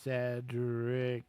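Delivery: Cedric drawn out low, syllable by syllable; slow, low, deliberate Cedric; really slowly. Shown as a demonstration. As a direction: stretch every sound of Cedric. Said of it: "Say his name really slowly".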